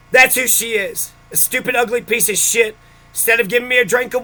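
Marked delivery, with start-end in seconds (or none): none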